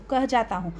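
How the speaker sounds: ordinary delivery, nothing marked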